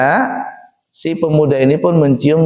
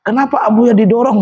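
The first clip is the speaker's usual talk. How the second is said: angry pilgrim; 7 semitones higher than usual. Retold or imitated. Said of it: "ada yang marah".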